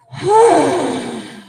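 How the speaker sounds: long loud audible sigh out; dramatic sigh. Shown as a demonstration. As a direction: heave a big releasing sigh. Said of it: "Big sigh out".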